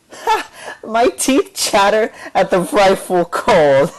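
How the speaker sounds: laughing mockery. A narrator's reading. Bassist mocking Candida's poem, laughing loudly. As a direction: read it while laughing at her. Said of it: "laughed the bassist".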